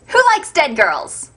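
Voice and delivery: normal talking voice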